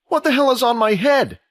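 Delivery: high-pitched startled student's outcry; panicked student crying out high; alarmed shout. A narrator's reading. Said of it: "the student shouted out".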